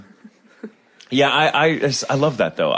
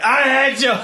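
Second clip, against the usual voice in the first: much higher put-on cry; mock meltdown, pitched way up; hammed-up emotional outburst; 13 semitones higher than usual. The crazy one turning on a dime, hammed-up outburst. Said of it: "I'm full of emotion, but it's like, it's turning on a dime".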